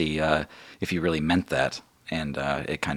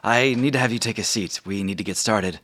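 Margot the guild leader; 5 semitones higher, 4 dB louder than usual. Acted. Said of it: "and she says".

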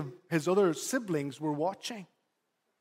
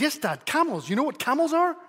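put-on higher, insistent little boy; impatient child voice; 8 semitones higher, 3 dB louder than usual. Son correcting his father, higher excited child voice. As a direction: play the son schooling his dad, higher and louder. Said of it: "And he says".

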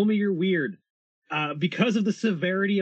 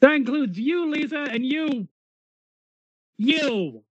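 put-on refereeing kids in car; 10 semitones higher, 4 dB louder, slower than usual. Driver refereeing passengers.

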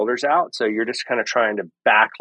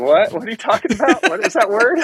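baffled musician; 12 semitones higher than usual. Retold or imitated. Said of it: "And they'd be like".

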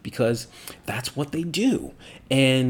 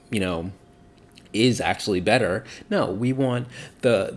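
usual speaking voice

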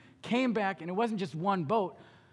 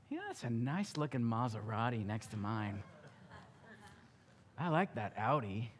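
musing to oneself; 9 semitones lower, 8 dB quieter than usual. Acted. Admiring cars in parking lot.

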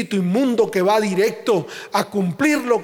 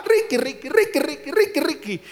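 calling a pet; 6 semitones higher than usual. Pretend. Calling a dog on leash.

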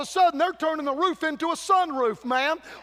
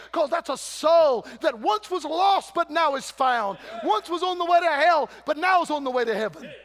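preaching at full force; 2 semitones higher than usual. Acted. Preacher urging church to bring souls, fervent. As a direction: build the soul-was-lost line into a shout.

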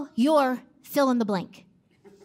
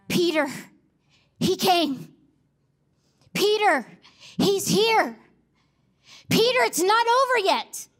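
higher, urgent calling out to Peter; excited calling; 4 semitones higher than usual. Acted. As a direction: tell him the news, can't hold it in.

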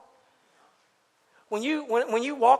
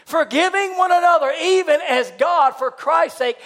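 loud, raised scripture reading; preached verse; 7 semitones higher, 8 dB louder, slower than usual. Acted. Preacher reads forgiveness verse to the congregation, loud and raised. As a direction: ring the verse out slowly to the room.

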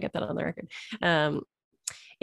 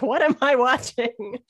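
higher, slow mock bewilderment; baffled disbelief; 6 semitones higher, 5 dB louder, slower than usual. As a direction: stretch it out like you can't believe your eyes. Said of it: "I was like".

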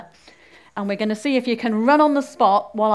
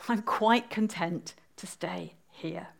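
soft, slow, low murmur; quietly content; 4 semitones lower, 6 dB quieter, slower than usual. Acted. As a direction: let it fade nearly to a whisper. Tired Alice declines to run on.

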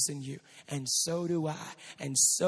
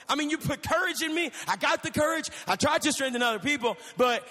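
high, loud, panicked confession; freaked-out Peter; 7 semitones higher, 3 dB louder than usual. Acted. Preacher voicing Peter panicking after Jesus returns. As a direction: blurt it out in a frantic rush. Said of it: "Peter is understandably freaked out".

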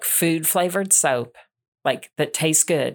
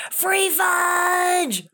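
high, long-drawn imitation yell; mock excited shout; 13 semitones higher than usual. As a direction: holler it like an overexcited big guy. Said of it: "this big guy with this junior hire go".

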